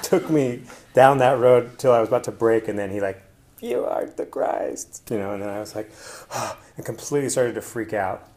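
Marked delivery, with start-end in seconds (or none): 3.6-5.1 slow, high, hushed pronouncement; spirit voice. Retold or imitated. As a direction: say it slowly like a spirit revealing a truth. Voicing the plant spirit.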